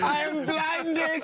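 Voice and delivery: mock agony at the sunlight; mock melodrama. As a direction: overplay a blinded tragic hero. Mocking actor's over-the-top reaction.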